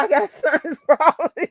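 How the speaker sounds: high mocking voice breaking into a laugh; mocking a friend while laughing; laughing mock quote. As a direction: laugh while mimicking his excuse. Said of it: "You up there talking about".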